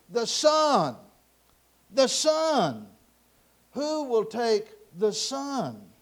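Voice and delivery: loud, lifted, slowing on the last question; insistent auctioneer's call. Acted. Auctioneer pressing a reluctant crowd, calling out insistently. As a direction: keep calling for any taker.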